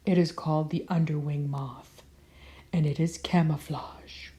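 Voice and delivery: hushed secret whisper. Acted. Hiding moth, secret fact.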